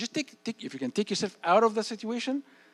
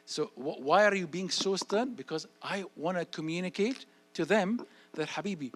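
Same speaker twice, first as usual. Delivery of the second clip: normal talking voice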